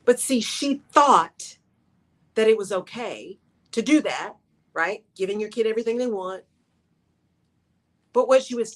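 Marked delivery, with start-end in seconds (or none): none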